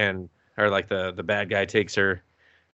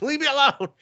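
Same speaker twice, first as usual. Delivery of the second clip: high, hammed-up whiny plea; mock exasperated hero. Hero pushing away the orphan girls, hammed-up high whine. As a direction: whine it like a cornered guy.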